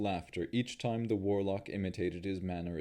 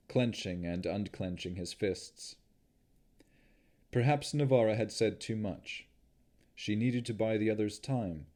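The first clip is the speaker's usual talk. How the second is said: usual speaking voice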